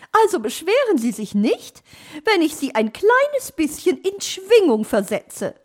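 high, loud character voice; bird character. Acted. Talking someone into being swung a little, high loud character voice. Story figure complaining on high voice.

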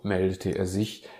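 ordinary delivery, nothing marked

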